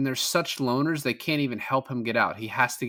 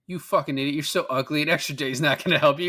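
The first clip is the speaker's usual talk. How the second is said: mock insult; 4 semitones higher, 4 dB louder than usual. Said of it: "He's like".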